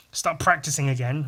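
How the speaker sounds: ordinary delivery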